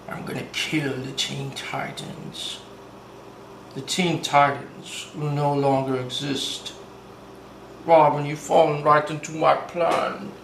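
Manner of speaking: hammed-up low villain gloating; bad Slade voice. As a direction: growl out the threat like a cartoon mastermind. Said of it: "I can't do a good Slade impersonation".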